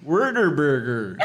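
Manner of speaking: put-on Whataburger as slow wor-ta-burger in a Texas accent; imitating Texas accent. Guest copying a thick Texas accent. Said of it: "a thick Texas accent".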